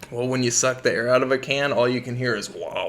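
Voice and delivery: ordinary delivery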